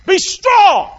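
high, loud cry of encouragement; rousing shout. Acted. Preacher urging the church to be strong, shouting. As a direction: call it out like a rallying cry.

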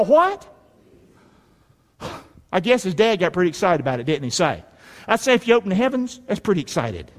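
usual speaking voice

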